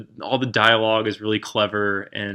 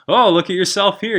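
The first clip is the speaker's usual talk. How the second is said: Merlin's delight; 9 semitones higher, 6 dB louder than usual. Retold or imitated. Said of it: "he's like".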